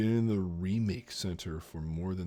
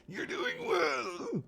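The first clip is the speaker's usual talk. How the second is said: extremely high, slow, drawn-out falsetto; absurdly high mock Capitol voice; squeaky Capitol voice; much slower than usual. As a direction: squeal it slowly as a hammed-up Capitol stylist. Redoes quote in squeaky Capitol voice.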